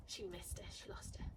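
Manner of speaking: whispered aside, no voice; quietly whispered commentary. Real, not acted. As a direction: hush it so the dog keeps searching. Whispering aside during training.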